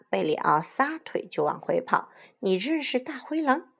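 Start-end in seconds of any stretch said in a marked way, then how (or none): 2.4-3.7 high, surprised query; puzzled question. Acted. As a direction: ask in wonder, pitch up. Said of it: "卡门奇怪地问小红帽". (惊奇地发问)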